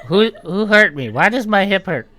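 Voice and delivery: high, loud, bewildered whine; confused old gnome. Acted. Dazed old gnome asking about his hip, high loud whine. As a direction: cry out lost and aching.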